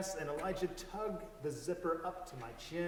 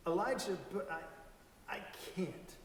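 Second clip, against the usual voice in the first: stammering; 3 semitones higher than usual. Acted. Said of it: "I stammered".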